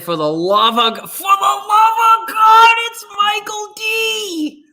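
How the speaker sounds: high, drawn-out Philly shout; Philly impression. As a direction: holler it in a hammed-up Philly accent. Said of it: "I think they really like my Philly impression".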